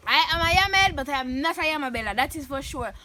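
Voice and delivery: ordinary talk, nothing marked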